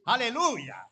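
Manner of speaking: loud, high joyful cry; exultant shout. Real, not acted. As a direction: burst out in praise. Pastor's exultant cry after testimony. (grito de alabanza, alto y fuerte)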